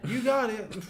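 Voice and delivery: high-pitched hyped gym bro; gym guy impression. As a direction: mimic the eager gym guy. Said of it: "they said".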